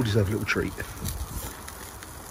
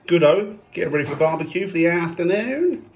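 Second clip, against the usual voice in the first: higher-pitched bad Aussie accent; bad Australian accent; 6 semitones higher, 4 dB louder than usual. Dad announces the barbecue, bad Australian accent. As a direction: put on a poor Australian accent. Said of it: "I said that in a bad Australian accent".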